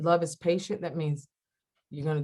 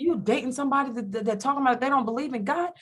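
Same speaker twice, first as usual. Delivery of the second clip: rapid high scolding, building louder; mad friend; 9 semitones higher, 4 dB louder, faster than usual. Retold or imitated. Best friend scolding her over dating him, acted angry tirade. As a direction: rant faster, then hit each question harder and higher. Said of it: "like, she was mad".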